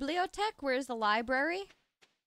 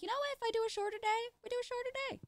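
squeaky high, light imitation of herself; lightly voiced chirpy self-talk; high mock voice; 12 semitones higher, much faster than usual. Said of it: "And now I'm like".

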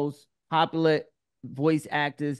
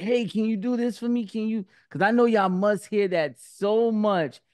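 lightly imitated fan asking a favor, pitched up; eager fan; 6 semitones higher than usual. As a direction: pitch it up a little like a pleading fan. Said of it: "people come up to you and like".

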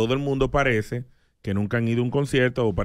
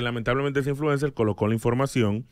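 usual speaking voice

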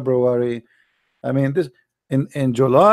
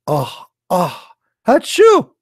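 fake sneeze; 4 semitones higher, 4 dB louder, much slower than usual. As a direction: sneeze rising high and loud. Acting out a sneeze.

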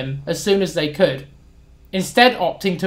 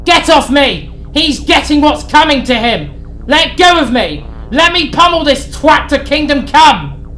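much higher, louder enraged yells; furious shouting; 7 semitones higher, 5 dB louder than usual. Acted. Sid shouts at Harry while restrained. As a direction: strain to break free. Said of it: "continued to shout".

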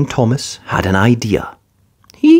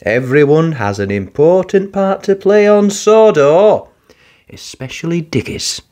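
Sir Topham Hatt; 6 semitones higher than usual. Acted. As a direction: praise him grandly.

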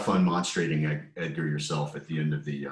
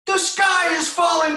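very high loud shouted peep; panicked peep; 10 dB louder than usual. Acted. Reading aloud, the smallest chicken panics at the acorn. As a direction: shriek the alarm like a tiny chick. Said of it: "she peeped".

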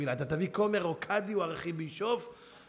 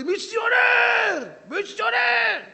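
much higher, slow drawn-out yell; shouting accusation; 10 dB louder, much slower than usual. Retold or imitated. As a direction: scream the accusation. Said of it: "הוא קם צועק לי".

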